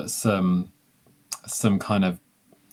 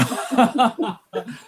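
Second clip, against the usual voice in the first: high embarrassed laugh. Real, not acted. Reacting to the Red Dwarf link.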